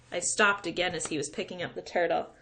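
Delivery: usual speaking voice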